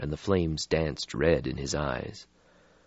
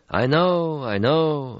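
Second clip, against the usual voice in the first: put-on old European, slow and melancholy; weary old vampire; 10 semitones higher, 4 dB louder, much slower than usual. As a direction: sigh out an ancient regret. Stretsky voiced in story.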